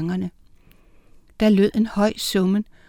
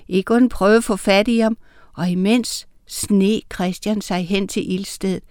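usual speaking voice

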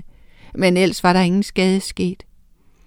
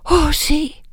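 gasp; 7 semitones higher than usual. A narrator's reading. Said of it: "gispede Miranda".

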